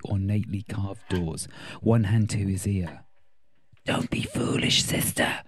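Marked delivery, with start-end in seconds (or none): 3.8-5.4 low hissing whisper; hissed whisper. Acted. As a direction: hiss it through the teeth. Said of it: "Pyro hissed".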